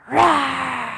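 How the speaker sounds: loud roaring lion sound. Shown as a demonstration. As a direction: roar out like a lion on the exhale. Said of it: "you're going to roar like a lion".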